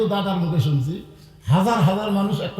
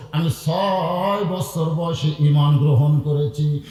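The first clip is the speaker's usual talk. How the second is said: slow, stretched telling of a sorrow; slow lament; slower than usual. Acted. Woman telling Omar her greatest sorrow, slow lament. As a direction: draw out "ছয়" long, at usual pitch.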